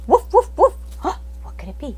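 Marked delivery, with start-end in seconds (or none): 0.1-1.4 high loud barks then a gasp; dog barking sound effect. Shown as a demonstration. Teacher demoing sound-effect hints. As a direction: bark then gasp in surprise. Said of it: "sound effects".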